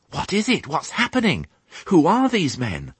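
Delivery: high, anxious little piglet voice; young piglet asking. A narrator's reading. Piglet asks old sheep about the men, bewildered. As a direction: fire off high, puzzled questions. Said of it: "asked Babe".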